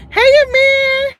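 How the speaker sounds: high, loud, drawn-out hello; real stretched-out call to someone joining; calling out greeting. Real, not acted. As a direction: sing out a long, loud greeting.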